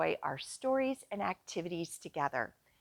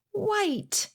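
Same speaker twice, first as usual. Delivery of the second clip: high answer; 9 semitones higher than usual. Acted. Answering own quiz question.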